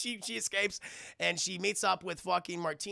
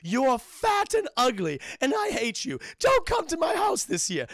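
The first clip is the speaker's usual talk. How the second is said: high, loud mock-kid voice; hammed-up spite, pitched way up; hateful child's letter; 7 semitones higher, 5 dB louder than usual. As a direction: yell it like a bratty letter writer. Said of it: "They're basically saying".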